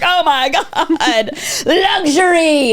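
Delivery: high, drawn-out swoon with laughter; mock awe. Teenager amazed by free office snacks, hammed-up awe. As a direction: marvel as if at untold riches. Awestruck reaction to startup snacks.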